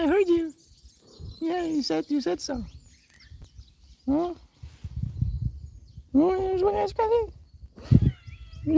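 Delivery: put-on very high squeaky baby talk to the cats. Streamer cooing at her cats in a high baby voice. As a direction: coo at the kitties in a tiny high voice.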